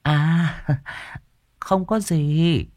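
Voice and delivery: lightly voiced mistress, feigning ease; a sly landowner's wife brushing off her servant; lady's voice, coy. Mistress to servant Dần, coy and high.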